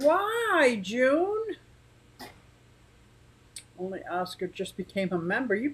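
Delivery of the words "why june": high, questioning mock outrage; mock indignant. Real, not acted. Vlogger playfully protests a viewer unmembering her. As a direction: act wounded at a friend's joke.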